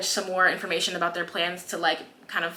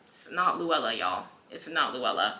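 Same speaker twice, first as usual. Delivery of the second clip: much softer, lower, beaten down; defeated mutter; 9 semitones lower, 9 dB quieter than usual. Retold or imitated. Haymitch quietly telling the others the girl isn't Luella, soft and defeated. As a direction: say it low, like all the fight has drained out.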